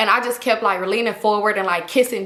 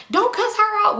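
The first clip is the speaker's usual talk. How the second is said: high-pitched mock plea; protesting; 11 semitones higher than usual. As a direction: jump to her defence, pitched high. Acted drunken plea defending friend.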